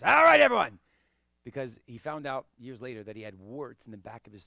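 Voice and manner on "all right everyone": put-on loud, high raspy rasp of his old teacher. Instructor imitating his old teacher's raspy voice to students. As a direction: croak it out like a throat full of gravel. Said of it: "he talked like this".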